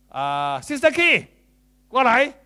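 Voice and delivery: drawn-out name, then loud, high call. Shown as a demonstration. Preacher demonstrating how to call Sister Key over, loud and high. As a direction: summon her from across the room. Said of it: "表演一下好不好".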